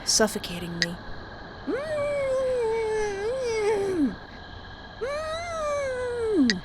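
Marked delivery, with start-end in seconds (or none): 1.7-6.6 high pitched muffled cries through a hand; muffled high whimper. Acted. Smothered, crying out through his hand. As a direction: strain against the hand. Mouth covered, suffocating.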